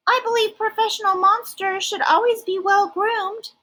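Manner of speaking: very high, smooth and polite monster; high silky Ralph. Acted. Ralph offers his claws for inspection, very high and silky. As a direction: keep it high and silky, very proper. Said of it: "high, silky voice".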